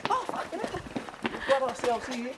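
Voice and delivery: high excited wildlife host. Pretend. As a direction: squeal it out in wonder. Excited wildlife host spotting critter.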